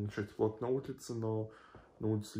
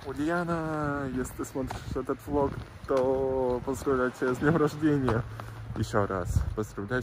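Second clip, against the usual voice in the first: loud greeting; 4 semitones higher, 8 dB louder than usual. Real, not acted. Birthday greeting to niece.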